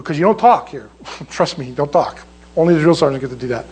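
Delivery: ordinary talk, nothing marked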